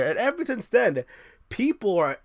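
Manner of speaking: ordinary talk, nothing marked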